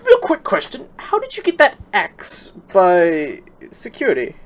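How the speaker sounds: high-pitched, wary questioning; high nervous voice. Acted. Player character asks nervously.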